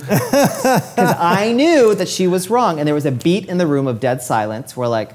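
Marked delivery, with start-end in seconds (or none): 0.0-2.8 slow, laughing through the words. Real, not acted. Telling the host about correcting the editor, laughing slowly. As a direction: chuckle it out slowly, amused at himself.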